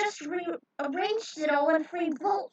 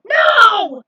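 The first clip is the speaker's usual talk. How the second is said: desperate shout; 11 semitones higher, 19 dB louder than usual. Acted. Trio trapped as door slams.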